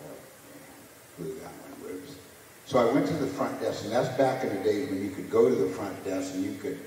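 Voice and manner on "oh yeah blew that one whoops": whispered thought. Retold or imitated. Recalled inner thought of regret.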